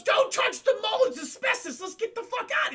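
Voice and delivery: high, squawking yell of alarm; mock panicked warning. Mario warns Luigi off the mold, high mock panic. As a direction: yelp the warning in hammed-up panic.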